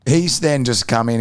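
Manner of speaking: normal talking voice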